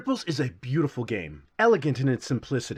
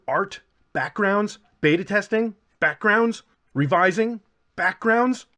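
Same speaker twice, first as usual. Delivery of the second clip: a running joke: backgrounds pushed harder each time; stress on backgrounds; 6 semitones higher, slower than usual. Real, not acted. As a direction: keep hitting the word backgrounds, building. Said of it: "have I mentioned I make backgrounds?".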